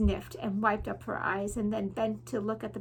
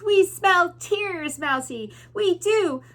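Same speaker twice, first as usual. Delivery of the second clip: higher, louder, slow taunting rat chorus; shouting rats; 7 semitones higher, 6 dB louder, slower than usual. Acted. Rats taunting the crying mouse, slow loud jeer. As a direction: sneer and drag out the words. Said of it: "shouted the rats".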